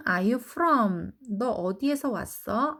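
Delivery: usual speaking voice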